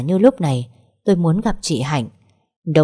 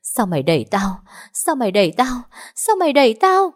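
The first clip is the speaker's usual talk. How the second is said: accusation building from usual pitch to very high; tearful roar; 6 semitones higher than usual. A narrator's reading. Injured woman accuses the narrator of pushing her, roaring through tears. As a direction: escalate into a high, anguished outburst. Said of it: "Hai dòng nước mắt lã chã gầm lên".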